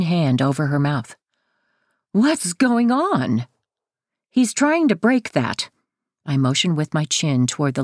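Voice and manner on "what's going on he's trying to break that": high, alarmed exclamation. A narrator's reading. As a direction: pitch it up in mild alarm. Mother walks in on struggle.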